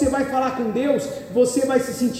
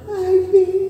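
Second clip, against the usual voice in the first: higher, airy, put-on little voice; meek sly wife; 8 semitones higher than usual. Preacher mimicking the passive wife to the congregation, high meek voice. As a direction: sweet-talk like a mousy wife who never objects. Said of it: "A sonsa não".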